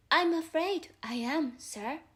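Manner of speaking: young Alice explaining herself to the caterpillar, lightly voiced. Alice apologises.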